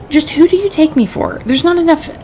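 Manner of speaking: high, offended scolding; how dare you; high-pitched indignant rebuke. Acted. Clerk scolds a cheating traveler.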